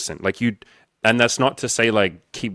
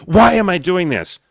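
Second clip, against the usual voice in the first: much higher and louder, a falling yell at yourself; angry self-accusing statement; 9 semitones higher, 7 dB louder than usual. Shown as a demonstration. Coach imitating a client beating themselves up, angry falling yell. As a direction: bark it as a self-attack, not a question. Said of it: "That's an angry yelling at yourself statement".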